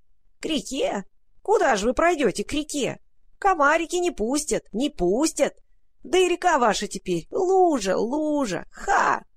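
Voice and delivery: squeaking rat voice. Acted. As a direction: screech it like a nasty rat. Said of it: "мерзкий крысиный голос продолжал скрипеть".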